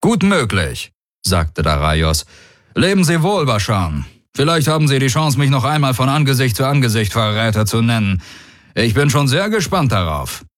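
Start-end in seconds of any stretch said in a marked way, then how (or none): 0.0-0.9 high, lifted commander voice; confident warlord. Acted. Daraios assuring the commander of his oath, high and confident. 2.8-10.4 high, brisk, confident farewell; taunting warlord. Acted. Daraios bidding the commander farewell, high and taunting.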